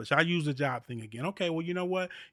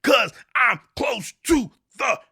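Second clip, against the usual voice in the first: quoting the song like you're at your limit; rising to a near-yell on edge; frustrated shout; 6 semitones higher, 9 dB louder, slower than usual. Retold or imitated. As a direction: belt the old-school lyric out, high and loud. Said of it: "we're like".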